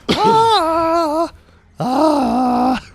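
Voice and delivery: loud, very high wordless wail; failed high note. Retold or imitated. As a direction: imitate a singer missing the high note loudly. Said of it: "she could just hear me going".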